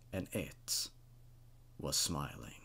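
breathy near-whisper on the last words; whispered horror punchline; hushed reveal. A narrator's reading. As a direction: let the final twist die away to a whisper. Story's creepy final reveal.

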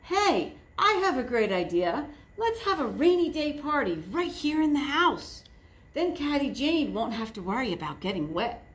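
high, fast, eager; excited Piggy. Acted. Piggy proposing a party to her friends, high and eager. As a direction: blurt out a great idea. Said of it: "said Piggy".

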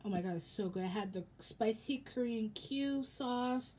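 ordinary talk

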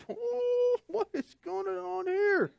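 high, soft, drawn-out disbelief; shocked disciple. Acted. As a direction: gasp it out like a stunned onlooker. Disciples' shocked thought, acted.

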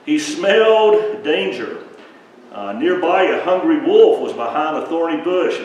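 put-on dramatic children's-story suspense; suspenseful narration. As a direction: build the danger for the kids.

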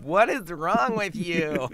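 high, loud, drawn-out mock disgust; appalled reaction. Woman recoiling from his pickup line, mock appalled. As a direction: stretch it out in exaggerated disbelief.

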